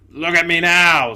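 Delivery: loud, gruff ghost exclaiming; indignant big ghost. Acted. Big ghost to the bright spirit, loud indignation. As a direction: blurt it out loudly, amazed and offended.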